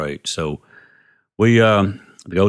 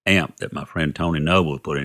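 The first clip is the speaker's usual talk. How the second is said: ordinary talk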